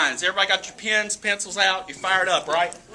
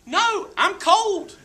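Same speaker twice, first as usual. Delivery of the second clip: put-on kid whining; 9 semitones higher, 3 dB louder than usual. Boy whining to his dad on the cold boat. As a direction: whine like a kid who wants off the boat. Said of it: "and I'm whining".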